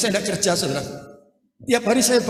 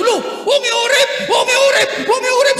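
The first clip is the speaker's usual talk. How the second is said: high, loud amazed cries; astonished mourners; 13 semitones higher, 4 dB louder than usual. Retold or imitated. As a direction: shout in disbelief that she lives. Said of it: "Orang nyelawat bingung". (teriakan kaget para pelayat)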